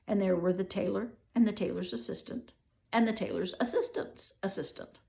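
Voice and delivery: usual speaking voice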